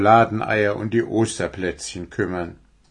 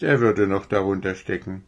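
usual speaking voice

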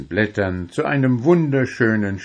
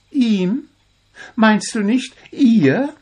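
much higher, quizzical; Zwitschi, puzzled; 14 semitones higher, 4 dB louder, slower than usual. A narrator's reading. Zwitschi questions Puh's wording, high and puzzled. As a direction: question it in disbelief.

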